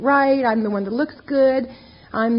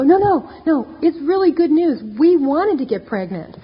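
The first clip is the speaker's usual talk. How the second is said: higher, insistent cheerful reassurance; eager reassurance; 4 semitones higher, 4 dB louder than usual. Retold or imitated. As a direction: insist it's good news. Reassuring shocked therapists.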